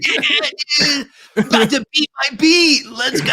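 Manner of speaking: high-pitched, laughing rallying cry; hyped cheer. Real, not acted. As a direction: call it out high to pump up the group. Hyping up a friend on stream.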